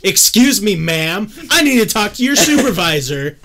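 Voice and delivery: high, loud, hammed-up outrage; mock indignant complaint. Customer demanding the waitress's supervisor, mock indignant. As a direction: play the affronted customer demanding action.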